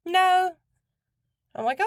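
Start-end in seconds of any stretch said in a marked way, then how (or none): 0.1-0.5 put-on little boy voice. Mimicking toddler answering.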